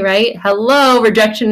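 ordinary talk, nothing marked

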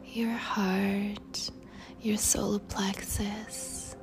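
ordinary delivery

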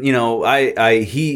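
usual speaking voice

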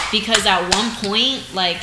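ordinary talk

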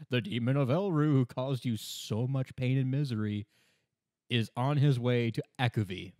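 usual speaking voice

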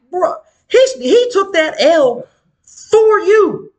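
high-pitched, slowly drawn-out protest; incredulous protest. Retold or imitated. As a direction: plead his case in disbelief, stretching the words. Retold argument defending the boyfriend.

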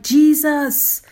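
loud, high plea for mercy, falling back at the end; preacher acting a beggar's loud cry. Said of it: "He cried".

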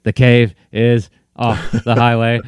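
slow, halting robot delivery; stilted, mechanical recital; malfunctioning Bumblebee. Retold or imitated. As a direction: spell it out word by word like a glitching machine. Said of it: "memory chips got messed up".

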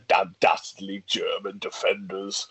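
low, slow melodramatic storytelling; mock villainous disdain. Telling the dastardly German defenders story, mock disdain. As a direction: draw it out like a pantomime narrator.

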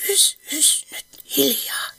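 urgent hushing whisper. Acted. Hilma secretly hushes someone inside, whispering. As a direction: hush them in a whisper.

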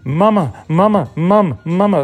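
put-on high, whiny little-kid "mama" over and over; imitating the toddler. Dad imitating his four-year-old nagging mom, high and whiny. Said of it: "that's in her ear all over the time".

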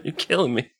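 laughing through the words; laughing protest. Real, not acted. Cracking up at a co-host's fake Superman bravado, laughing. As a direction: beg him to stop while cracking up.

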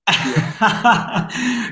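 amused open laugh. Real, not acted. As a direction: burst out laughing. Host laughs at mask joke.

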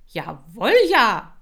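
loud, rising eager squeal; squealing shout. Acted. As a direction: shriek along with the kids. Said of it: "kreischte ich". (laut kreischend nachgemacht)

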